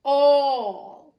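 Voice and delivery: All punched high and loud; great emphasis. A narrator's reading. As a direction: hammer the single word All. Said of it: "he repeated with great emphasis".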